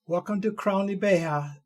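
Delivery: usual speaking voice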